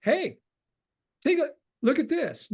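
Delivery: high, bright eager call; eager sharing. Retold or imitated. Showing someone his blueprint, high eager excitement. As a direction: pull someone over to see.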